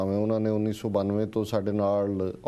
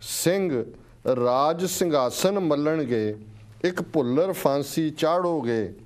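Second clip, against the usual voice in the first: slow, measured verse with swelling, falling line ends; stirring, drawn-out poetry reading; poem recitation; 4 semitones higher, slower than usual. Real, not acted. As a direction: declaim each line deliberately, pausing between phrases. Recites a listener's poem aloud.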